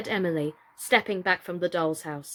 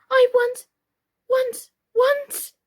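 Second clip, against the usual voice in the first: much higher, louder broken repeats; stuck and crying; 8 dB louder, slower than usual. A narrator's reading. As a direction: sputter the word stuck on it. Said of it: "head rattled so she could not say".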